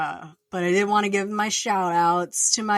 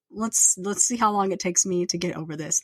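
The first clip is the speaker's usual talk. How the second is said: ordinary talk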